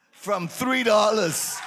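slow, loud, amazed shout. Real, not acted. Pastor shouting in awe at the three-dollar blessing. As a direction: stretch it out in pure astonishment.

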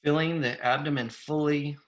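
unhurried meditation guide with long pauses; guided meditation. Real, not acted. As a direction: invite them to settle, phrases lifting at the ends.

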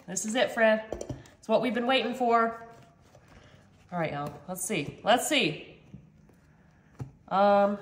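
normal talking voice

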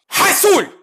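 high, loud French attempt at Arabic; mock a French pal trying Arabic; exclaimed would-be Arabic, French accent; Frenchman attempting Arabic. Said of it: "P O V, ce pote, euh, français qui, qui veut parler arabe".

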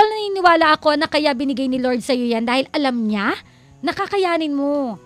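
ordinary talk

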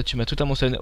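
normal talking voice

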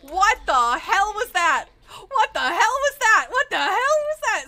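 loud, high panicked yelling; shocked scream. Real, not acted. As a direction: shriek it as the killer lands a hit. Chased by killer, shocked.

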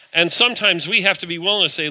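normal talking voice

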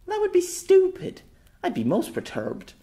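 high, loud indignant outburst; indignant girl. Acted. Girl indignant at the thought of goblins, high and loud. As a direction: huff at the silly goblins.

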